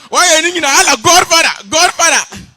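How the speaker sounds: very high, full-volume hailing; security man's shout. Acted. Security man hailing the supposed boss, high excited shout. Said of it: "He said".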